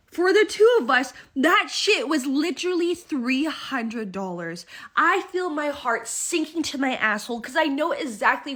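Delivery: loud, high burst of disbelief at the bill; shocked outburst. Retold or imitated. Telling friends about the three hundred dollar bill, shocked. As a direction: blurt it out, stunned and outraged.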